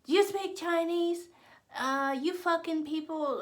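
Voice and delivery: high, loud mocking imitation of a hostile stranger. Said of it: "all kind of racial slurs".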